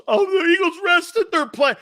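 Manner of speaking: high, loud whiny mock voice; mock Eagles fan. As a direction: whine it like a sore fan. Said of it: "without knowing the Eagles".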